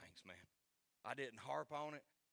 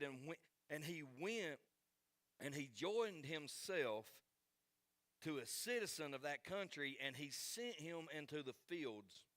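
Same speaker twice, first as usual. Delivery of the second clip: high, loud pulpit reading; ringing Bible reading with pauses between phrases; preacher reading scripture; 4 semitones higher, 5 dB louder than usual. Real, not acted. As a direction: proclaim the verse in emphatic phrases.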